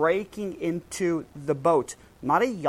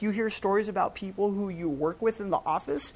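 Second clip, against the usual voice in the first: ordinary talk, nothing marked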